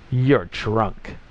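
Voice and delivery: understated sneer; mildly sneering accusation. A narrator's reading. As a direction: say it with a touch of contempt. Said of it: "it sneered".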